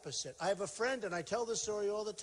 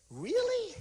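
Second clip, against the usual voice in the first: much higher, louder gushing "Really?"; mock surprise; 14 semitones higher, 4 dB louder than usual. Imitating her thrilled reaction to the job offer, high squeal. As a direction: squeal it in delighted disbelief. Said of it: "And she said".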